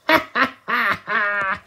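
high, loud cartoon villain cackle; evil cackle. Transformed villain gloating over the heroes, high evil cackle. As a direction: laugh like a triumphant little villain.

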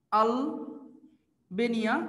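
"Albania" drawn out very slowly, split by a pause; slow dictation. Shown as a demonstration. Teacher dictating "Albania" for students to write, slow dictation. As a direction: stretch "Albania" out part by part, as if for note-takers.

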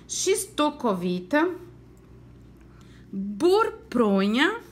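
"burpronha" drawn out slowly; slow, careful word. Shown as a demonstration. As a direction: stretch each syllable of "burpronha". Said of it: "quantas vezes vocês abriram a boquinha".

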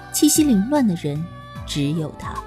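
ordinary delivery, nothing marked

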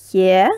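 ye as a full clear stressed vowel. Shown as a demonstration. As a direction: sound out ye distinctly. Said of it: "it is clear".

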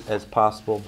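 usual speaking voice

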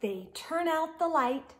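lively storybook read-aloud; expressive picture-book narration; reading to children. Acted. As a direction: read it to kids at bedtime, animated.

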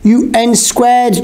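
high, drawn-out call; mild shouted interruption. A narrator's reading. George interrupts the two friends, mild high shout. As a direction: cut in high and slow, volume held back. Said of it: "George shouted, interrupting us".

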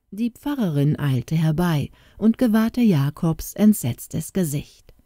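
usual speaking voice

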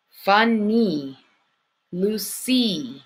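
funny and Lucy with long EE endings; syllable by syllable, stretched final EE; sounding out endings. Shown as a demonstration. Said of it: "Does that one sound like sky?".

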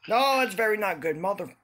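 loud exasperated outburst; frustrated outburst. Real, not acted. Player to himself after a fall, loud exasperated outburst. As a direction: blurt it out as the climb falls apart. Game fall ruins progress.

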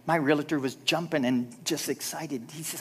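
ordinary talk, nothing marked

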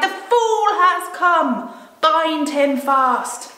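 high, loud, slow triumphant witch voice; slow, loud gloating witch; witch's command. Acted. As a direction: draw out the gloat, then order them to bind him. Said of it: "she cried".

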